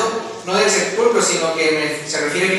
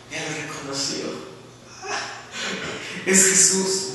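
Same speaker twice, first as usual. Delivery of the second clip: laughing softly; 4 semitones lower, 6 dB quieter than usual. Real, not acted. Laugh heard.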